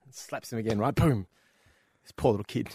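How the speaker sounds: ordinary talk, nothing marked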